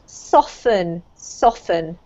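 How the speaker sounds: "soften" with the T left silent. Shown as a demonstration. Teacher correcting a student's word, T silent in "soften". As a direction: say "soften" as if spelt soffen. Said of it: "doesn't have a T sound".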